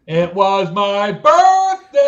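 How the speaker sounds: drawn-out shout of celebration; gleeful announcement. Real, not acted. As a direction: build to a loud, high whoop on the last word. Announcing own birthday in celebration.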